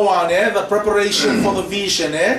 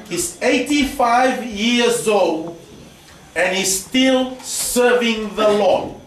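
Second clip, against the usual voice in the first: slow emphasis; much slower than usual. Real, not acted. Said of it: "preacher stressing a point slowly".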